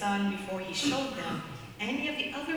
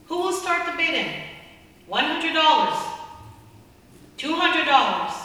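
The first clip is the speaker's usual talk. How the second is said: higher and louder, an auctioneer calling for bids; 7 semitones higher, 8 dB louder than usual. Acted. Auctioneer at the sale calls out the bids to the crowd, loud and high. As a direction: sell it to the room, voice up and loud.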